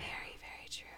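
soft unvoiced ASMR whisper. Real, not acted. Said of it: "That was for the A S M R listeners".

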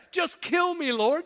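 loud, high mock-desperate cry; Jonah's despairing plea. Jonah begging the Lord after the worm, melodramatic despair. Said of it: "That's what he says".

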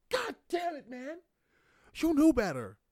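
high, strained, loud outburst at yourself; exasperated self-scolding. Acted. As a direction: berate yourself in a high-pitched cry. Acted self-scolding over broken yolk.

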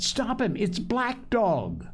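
high, urgent alarm; crying out. A narrator's reading. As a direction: raise the alarm, stretching the name. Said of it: "I cried".

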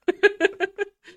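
sudden high, loud laugh; a real laugh that bursts out high; high burst of laughter. Real, not acted. Laughing at her own point about the losers, sudden high laugh.

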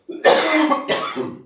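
sharp throat-clearing cough; real cough. Real, not acted. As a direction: cough it out hard. Hoest midden in de preek. (harde hoest)

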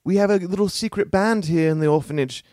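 put-on a kid missing the old songs; wistful orphan kid.

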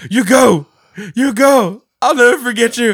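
high, loud melodramatic goodbye; hammed-up heroic farewell. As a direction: play the noble sacrifice to the hilt. Mock heroic farewell scene.

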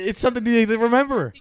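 loud, high-pitched objection; heated protest. Real, not acted. Host scolds forgetful friend on the phone, heated protest. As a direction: argue back at full volume.

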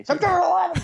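high shouted date; loud sharp voice. Pretend. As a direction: bark the date out at a stranger, high. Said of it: "why are you shouting dates at me".

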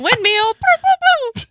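high, loud playful boops; silly boop sounds. Pretend. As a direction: squeal it like a spinning toy. Imitating spinning sound playfully.

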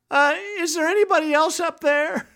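high, hesitant call upward; mock shout back. Climber seeking anyone else up there, high mock shout. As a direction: stretch it out in a high-pitched call. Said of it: "the guy shouts back".

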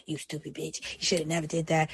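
harsh inner critic. Retold or imitated. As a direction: mutter a cruel put-down at yourself. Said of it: "What the hell am I gonna beat my ass for".

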